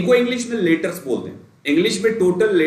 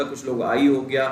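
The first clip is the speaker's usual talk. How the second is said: usual speaking voice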